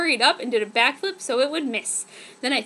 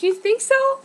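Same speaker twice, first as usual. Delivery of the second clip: blushing, much higher little voice; bashful; 11 semitones higher, 5 dB louder than usual. Acted. Girl flattered by a compliment, high and shy. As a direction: ask it shyly, flattered. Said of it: "I blushed and said".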